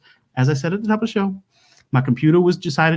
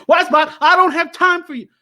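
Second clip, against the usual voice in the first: high, loud mock yell; a put-on higher yell at Wisebot; mock yell at the bot; 11 semitones higher, 4 dB louder than usual. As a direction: bark at the robot like it's beneath you. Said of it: "I'm not supposed to yell at Wisebot".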